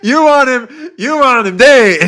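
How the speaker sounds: high, loud, through bursts of laughter; real laughing disbelief. Real, not acted. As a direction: crack up while calling out in disbelief. Cracking up at Sarah's post.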